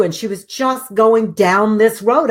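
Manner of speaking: ordinary talk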